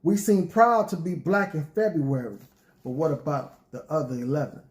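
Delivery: rhythmic spoken word delivery; poetry reading. Acted. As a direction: perform it like a spoken word poet. Recites his poem.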